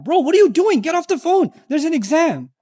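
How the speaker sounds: mock friend yelling to get off the phone; urgent call. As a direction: snap a friend out of it. Friend urging to study.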